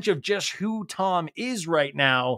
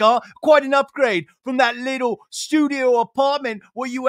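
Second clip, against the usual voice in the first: high, loud gloating impersonation; mocking DJ James; 8 semitones higher, 4 dB louder than usual. James taunting the dog about the new house, high gloating voice. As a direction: gloat and crow over the win. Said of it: "DJ James Kennedy's like".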